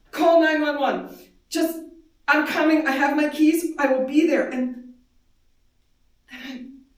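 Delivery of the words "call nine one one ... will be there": louder, firm and pressing; urgent instructions. Retold or imitated. Mother telling her scared daughter to call 911, loud and urgent. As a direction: push the orders through the phone.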